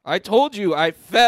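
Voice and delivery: high, loud, fed up; exasperated shout. Pretend. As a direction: yell it back in exasperation. Heated argument about the fall.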